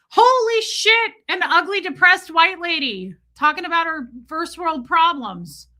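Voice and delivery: high, loud mock shriek; appalled talking snake. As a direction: play a scandalized snake shouting. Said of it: "And he was like".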